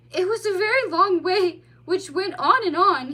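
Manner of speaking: put-on high, loud childlike voice; young otter. A narrator's reading. Otter describing his journey to the mole, childlike. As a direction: tell of the long trip wide-eyed.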